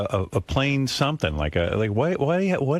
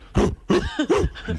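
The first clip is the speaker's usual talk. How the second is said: mock gasp of wonder. [name] amazed at new idea.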